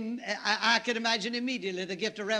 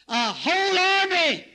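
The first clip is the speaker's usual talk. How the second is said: higher, louder and slower repeat; preaching emphasis; 8 semitones higher, 8 dB louder, slower than usual. Shown as a demonstration. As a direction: hammer it home from the pulpit. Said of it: "They sent a whole army".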